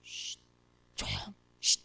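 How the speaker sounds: put-on hushed shh to noisy kids; soft scolding shush; shushing children. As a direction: whisper a hush. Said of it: "그럼 뭐라고 그래요".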